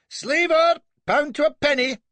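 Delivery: put-on high, loud young officer's cry; a keen young captain shouting; eager yell. A narrator's reading. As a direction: yell it out at the sight of a slaver. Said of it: "yells our young Nelson".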